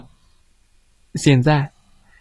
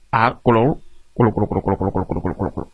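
low gurgle dying away to nothing; gurgling bubbles fading out; 6 semitones lower, much faster than usual. Acted. Bear gurgling in the tank, fading bubbles.

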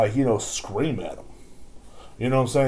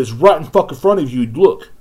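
loud, raised, exasperated pointing-out; acted-out sharp retort; 5 semitones higher, 10 dB louder than usual. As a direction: tell her off for not looking. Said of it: "I said just like that".

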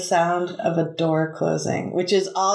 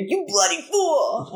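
much higher, slow duck squawk with a laugh in it; cursing duck voice; 9 semitones higher than usual. Retold or imitated. Hidden duck cursing at the cop arriving, slow and high. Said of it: "and you hear".

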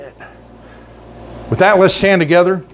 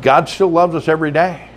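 ordinary talk

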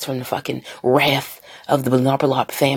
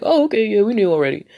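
higher and louder, breezy dismissive shrug; an imitated crowd going oh, okay, sure; mock nonchalance; 7 semitones higher, 5 dB louder than usual. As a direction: play it off like old news. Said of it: "everybody's just like".

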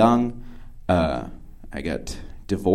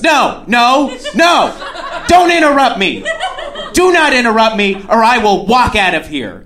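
imitated outburst, much higher and louder; angry scolding; 11 semitones higher, 4 dB louder than usual. Date threatens to walk out if interrupted, angry scolding. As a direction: cut him off with a high, forceful no.

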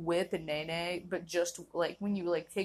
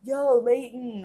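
louder and higher, calling out casually; bro greeting; 5 semitones higher, 10 dB louder, slower than usual. Retold or imitated. Friend calling out to Leighton, loud casual greeting. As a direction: hail your friend across the room. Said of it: "somebody be like".

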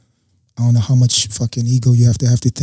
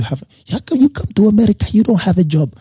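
higher, faster mock outrage; a landlady scolding a jobless tenant, higher and fast; flipping out; 9 semitones higher than usual. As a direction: imitate a landlady losing her temper. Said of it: "she starts flipping out".

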